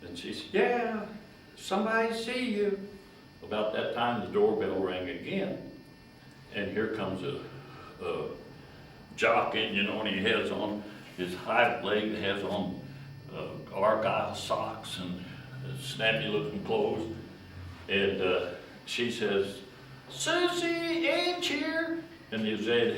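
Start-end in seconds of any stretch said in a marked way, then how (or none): 0.5-2.8 put-on high girlish voice calling down; calling back. Girl upstairs calling back to her friend. 20.1-22.0 high, slow sing-song announcement; drawn-out call. Retold or imitated. Girl at the stair calling out a slow high announcement.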